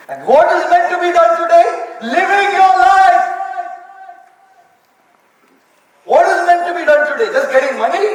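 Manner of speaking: loud, pressing questions to the hall; impassioned preaching. Real, not acted. Speaker challenging his audience about life, loud and impassioned. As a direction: drive every question home loudly.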